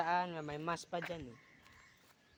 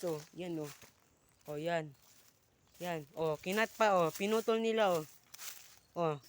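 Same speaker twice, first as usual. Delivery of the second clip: ordinary delivery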